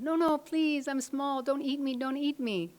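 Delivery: high, loud mock-terrified pleading; pleading frightened lion. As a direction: beg for your life in a high storybook voice. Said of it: "And the lion said".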